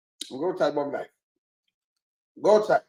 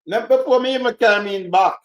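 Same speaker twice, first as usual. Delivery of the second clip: usual speaking voice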